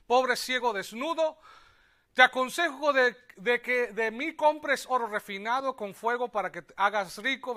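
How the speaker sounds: loud emphatic reading; forceful scripture preaching. Acted. Preacher reading Revelation to the church, loud and emphatic. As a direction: proclaim the verse to the church.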